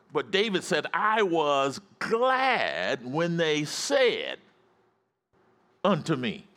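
high, emphatic sermon delivery; fired-up preaching. Real, not acted. Pastor urging congregation to be glad about church, fired-up preaching. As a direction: preach it high with stretched key words. Preacher rises to full force.

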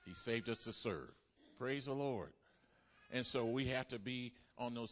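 loud, fast, driving sermon delivery; full-force preaching. Real, not acted. Preacher urging the church toward mercy, loud driving preaching. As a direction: push the message hard to the pews.

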